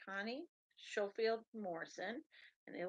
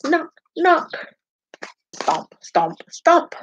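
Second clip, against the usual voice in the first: lively, sing-song picture book read-aloud; animated storytime voice with playful sound words; reading to children; 2 semitones lower, 25 dB louder than usual. Acted. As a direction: read it out to little listeners with bounce.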